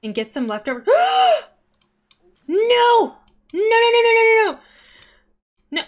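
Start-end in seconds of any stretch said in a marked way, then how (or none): 0.9-1.4 high, loud shocked gasp; sharp gasp. Real, not acted. Sudden bad event in game. 2.5-5.8 high, loud panicked wail of no; panicked cry. Real, not acted. Dismay at game disaster.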